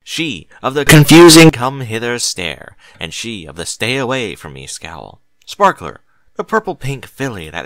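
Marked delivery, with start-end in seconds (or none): none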